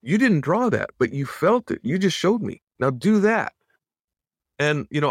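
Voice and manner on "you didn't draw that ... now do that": higher, urgent retelling of the mentor's push; Glenn's excited coaching. Retold or imitated. Animator voicing his mentor urging him to do it again, higher. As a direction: hand it back to him like an eager mentor. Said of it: "He says".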